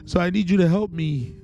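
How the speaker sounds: pleading prayer relived with rising pitch; desperate prayer. Retold or imitated. Retold desperate prayer while visibly distressed.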